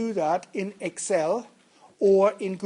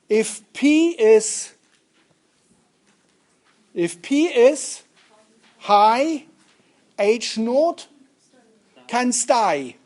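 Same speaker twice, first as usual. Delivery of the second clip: halting, deliberate recital with long pauses; slow mnemonic; 2 semitones higher, 4 dB louder, slower than usual. Shown as a demonstration. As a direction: lay out the rhyme piece by piece.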